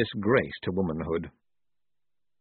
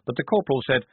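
usual speaking voice

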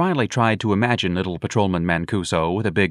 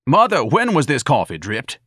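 loud, raised-pitch imperious question; demanding; 7 semitones higher, 5 dB louder than usual. A narrator's reading. Ignatius confronting his mother over coffee, demanding. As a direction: demand an answer, high and forceful. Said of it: "Ignatius demanded".

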